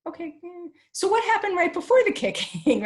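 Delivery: hammed-up patient parent coaxing the child. Shown as a demonstration. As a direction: ask it again in a bright, exaggeratedly patient parent voice. Parent repeats question to child.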